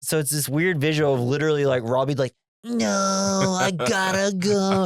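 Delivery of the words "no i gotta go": high, slow pleading son voice; high whiny teen. Retold or imitated. Son begging his dad to let him go, high and slow. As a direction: drag it out high like a kid begging to leave. Robbie voiced pulling away.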